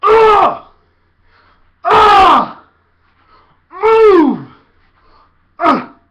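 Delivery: very high, very loud shouts; shouting sounds. Shown as a demonstration. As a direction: blast them with noise. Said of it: "You can make a sound".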